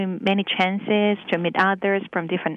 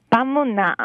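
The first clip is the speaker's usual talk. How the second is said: Busan dialect phrase, pronounced carefully on its own; Busan accent; much faster than usual. Shown as a demonstration. Local giving a sample everyday sentence, Busan accent. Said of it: "Busan accent somehow sounds".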